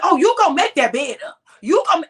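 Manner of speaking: hammed-up scolding mother; mock angry mother. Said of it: "She said".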